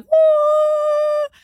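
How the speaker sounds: high loud mock whoop of joy; excited whoop. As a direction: let out a thrilled high woo. Retold joy two hours later.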